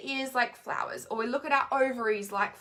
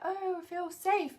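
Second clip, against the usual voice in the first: relieved inner voice; 7 semitones higher, slower than usual. Pretend. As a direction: let the words melt out, newly safe. Inner voice feeling safe, quoted.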